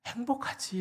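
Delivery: high, hushed "행복하지?"; sudden thought. Retold or imitated. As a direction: let "행복하지?" lift high and drop softly. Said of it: "이런 생각이 들더래요".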